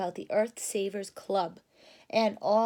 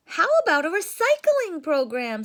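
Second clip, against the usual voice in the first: excited cub voice; higher, louder eager little cub; 7 semitones higher, 9 dB louder than usual. Acted. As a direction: chirp out the idea like a keen cub. A cub suggests.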